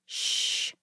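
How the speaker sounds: soft unvoiced shush; hushing shh. A narrator's reading. Girls hushing each other to hear the machine, soft shush. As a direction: hush the room so they can hear. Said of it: "said Estelle and Lena together".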